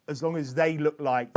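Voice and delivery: ordinary delivery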